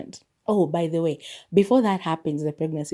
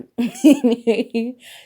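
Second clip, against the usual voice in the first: loud laugh; 6 semitones higher, 9 dB louder than usual. Real, not acted. Laughs after wrapping up recap.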